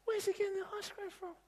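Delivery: put-on high, childlike wondering; jealous kids asking each other, high and curious. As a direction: pitch it way up like a nosy kid.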